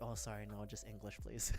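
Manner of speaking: low, soft retold apology; small, sheepish imitation of yourself apologizing. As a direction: drop the voice to a meek aside.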